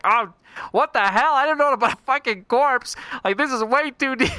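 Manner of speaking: high, loud squealing denial; mock panic. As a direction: yelp it out in fake alarm. Puzzler denies the corpse.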